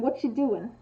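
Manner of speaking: relaxed, run-together question; casual interest. Shown as a demonstration. Contrasting two tones of one question, casually interested. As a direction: toss it off as a friendly query. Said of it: "implies that someone is interested".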